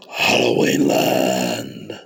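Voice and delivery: very low, slow creepy growl; deep, croaky Halloween menace; scary voice. Acted. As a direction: draw each word out like a spooky horror host. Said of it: "that scary voice".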